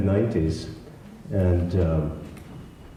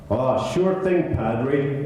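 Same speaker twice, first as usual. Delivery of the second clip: put-on a loose, higher stab at an American accent; bad American accent; 5 semitones higher, much slower than usual. A Scot faking an American accent for an easygoing reply to the cardinal. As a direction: attempt an American accent and miss it. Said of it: "I can't do an American accent".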